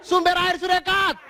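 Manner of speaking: high, slow shouted heckle; mock heckler shout. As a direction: yell it from the back row. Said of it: "di belakang ada yang berteriak".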